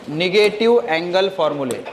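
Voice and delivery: slow, measured dictation. Shown as a demonstration. Teacher repeating a heading for notes, slow dictation. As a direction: give the heading at writing speed.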